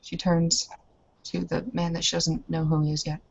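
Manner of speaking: ordinary talk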